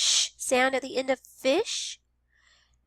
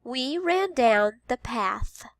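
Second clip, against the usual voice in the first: slow We ran down the path; slow teacher read-aloud; slow modeled sentence; slower than usual. Shown as a demonstration. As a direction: repeat slowly. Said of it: "What word has the th sound".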